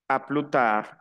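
"a plus tard" as "a plu taar", no S or D; careful French "a plus tard" with silent S; silent final letters. Shown as a demonstration. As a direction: model "a plus tard" with both endings dropped. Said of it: "Al final de una palabra no se pronuncia".

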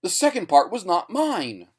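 high, loud insistence; loud, high-pitched plea; desperate pleading. A narrator's reading. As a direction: cry out, begging to be believed. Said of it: "his eyes pleading for belief".

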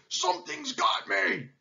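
high, shouted lion alarm; panicked roar. A narrator's reading. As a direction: cry out as if grabbed. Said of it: "roared the lion".

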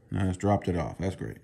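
ordinary talk

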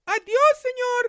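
put-on high, loud girl's goodbye; high loud girl's farewell; cheerful girl calling. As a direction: call out brightly after him.

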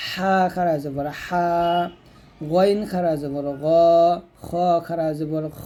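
throaty "হা", "গ", "খ", each final vowel held long; throat-letter articulation. Shown as a demonstration. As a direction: spell each, then stretch "হা", "গ", "খ" from the throat. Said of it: "তো এরকমভাবে পড়বেন".

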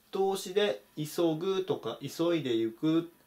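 usual speaking voice

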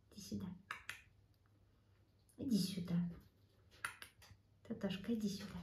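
soft whispered call; whispering. Real, not acted. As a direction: whisper the pet over.

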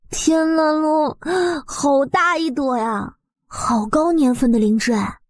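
high, drawn-out delighted amazement; amazed. Acted. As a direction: marvel at the huge mushroom. Amazed at huge lingzhi.

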